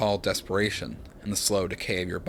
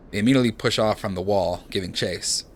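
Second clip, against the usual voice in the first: normal talking voice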